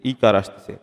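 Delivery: usual speaking voice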